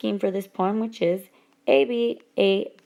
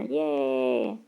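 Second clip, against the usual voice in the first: much lower, drawn-out yay; playful low cheer; 8 semitones lower than usual. Pretend. Teacher cheering the happy little pencil man, playful low cheer. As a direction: drop the voice low for a mock cheer.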